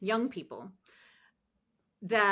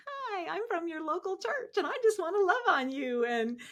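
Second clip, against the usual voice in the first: much higher, sing-song friendly voice; chirpy phone greeting to a stranger; overly sweet church caller; 10 semitones higher than usual. Retold or imitated. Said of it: "I'm calling them saying".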